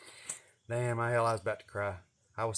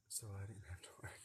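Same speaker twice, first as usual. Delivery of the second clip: whispered aside; 4 semitones lower, 20 dB quieter, much faster than usual. Real, not acted. Quiet aside after punchline.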